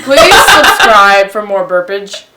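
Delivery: slow, loud, laughing through it. Real, not acted. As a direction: drag it out while giggling. Burp joke outro.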